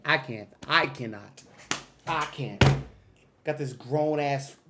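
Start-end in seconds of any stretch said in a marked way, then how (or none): none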